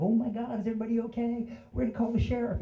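high, fretful old folks, imitated; worried old couple. Storyteller as old couple at crash, high and fretful. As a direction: play sweet old folks rushing to help. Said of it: "sweet, old, lovely couple".

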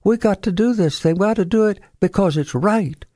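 put-on an old Southern writer insisting on what's right; imitating a Southern author's firm pronouncement; Faulkner impression. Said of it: "And he said".

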